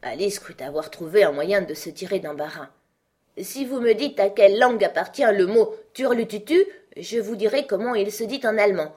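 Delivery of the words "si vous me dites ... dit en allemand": raised, confident counter-challenge; spring the clever answer with triumph; triumphant. A narrator's reading. Alice thinks she has escaped the difficulty, triumphant. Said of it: "d'un ton de triomphe".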